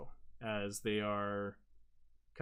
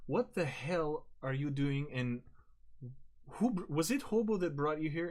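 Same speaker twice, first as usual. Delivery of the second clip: suspicious outburst; 6 semitones higher, 3 dB louder than usual. Acted. GM voices suspicious Jesus, accusing.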